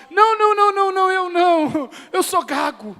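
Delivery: high, loud mock-frantic refusal; stammering excuse. Jeremiah claiming to stammer, hammed-up high refusal. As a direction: pile up the no's in a comic panic. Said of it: "Ou assim".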